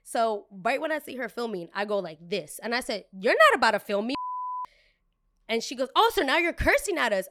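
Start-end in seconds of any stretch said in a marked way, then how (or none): 3.1-4.1 firm warning. Retold or imitated. Confronting a filming customer. 5.9-7.3 high, loud snotty customer; entitled customer. Retold or imitated. Customer's retort, voiced.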